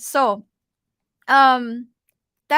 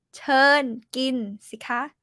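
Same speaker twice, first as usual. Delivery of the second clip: "Turn gin si ka" said very slowly, word by word; slow demo; much slower than usual. Shown as a demonstration. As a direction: spell it out slowly for the learner. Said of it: "You can add the word turn".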